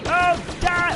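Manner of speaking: sudden high yelp at an enemy; excited game yell. Real, not acted. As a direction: cry out in the heat of the fight.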